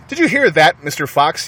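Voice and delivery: high, loud taunt down the hole; yelling. A narrator's reading. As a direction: shout it down into the hole. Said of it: "yelled Bean".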